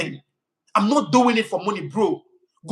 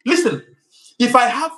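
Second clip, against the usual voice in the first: louder and higher, demanding attention; sharp raised call to listen; commanding; 4 semitones higher, 7 dB louder than usual. Real, not acted. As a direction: cut in to grab attention.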